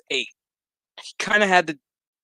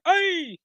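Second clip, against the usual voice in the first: sharp high yelp of pain; quick high ouch; 3 dB louder than usual. Retold or imitated. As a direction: yelp like your finger just got bent back. Finger popped back, pained yelp.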